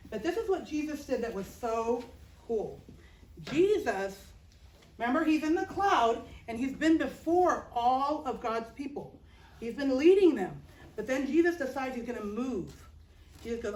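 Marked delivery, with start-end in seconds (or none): none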